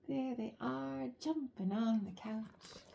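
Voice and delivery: ordinary delivery, nothing marked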